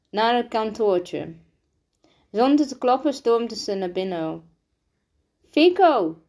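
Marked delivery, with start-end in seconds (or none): 5.5-6.2 high, loud urgent call; breathless shout. A narrator's reading. Rosa bursting into the office after running, breathless shout. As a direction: cry out the name, winded from running. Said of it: "riep ze, hijgend nog van het".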